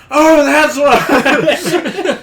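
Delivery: high, drawn-out "oh" of discovery; loud realization. Retold or imitated. Player retelling the moment it clicked, loud realization. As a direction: burst out loudly as it dawns on you.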